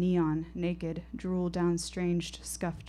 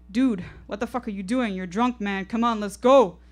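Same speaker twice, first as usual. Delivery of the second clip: louder pleading yell to his friend; alarmed shout; 3 semitones higher, 8 dB louder than usual. Acted. Mike screams at his drunk friend to come back, loud. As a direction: call him off from across the fence. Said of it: "screams Mike".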